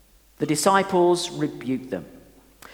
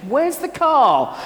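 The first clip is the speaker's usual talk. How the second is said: slow, much higher, louder cry of where's the car; alarmed call; 7 semitones higher, 6 dB louder, much slower than usual. Retold or imitated. Father leaving church finds car gone, alarmed call.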